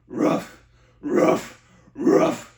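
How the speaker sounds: breathy rough barks; barked scream. Shown as a demonstration. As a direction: bark it with distortion. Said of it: "the rough method".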